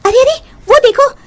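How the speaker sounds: high alarmed calling out; women shrieking at the spilled pot; startled cry. Acted. Villagers spot ghost at pot.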